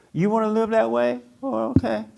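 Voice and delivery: light indifferent okay; mock easy acceptance.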